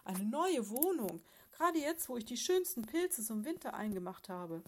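the mother bear, crying out in horror at moving; dismayed cry. Acted. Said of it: "rief Frau Tatzentopf entsetzt".

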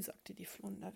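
ordinary delivery, nothing marked

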